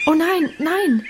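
a touch of eager excitement; excited denial. A narrator's reading. Minchen reassures after her shriek.

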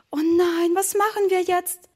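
high-pitched dismay; startled. Acted. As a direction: ask in alarm, pitch well up. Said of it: "fragte Emma erschrocken". (erschrocken gefragt)

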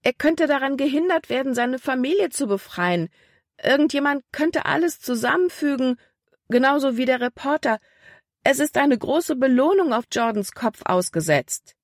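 put-on high-pitched worried young girl; young girl voiced high with mild alarm; anxious girl. A narrator's reading. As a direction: plead urgently as a frightened girl. Libby pleads in story.

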